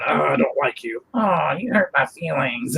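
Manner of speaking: lightly voiced sulky gnome; a hint of a touchy little gnome; grumpy gnome voice. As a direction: trade playful insults between toy figures. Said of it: "you got the voices really good".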